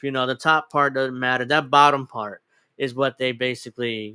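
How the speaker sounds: ordinary talk, nothing marked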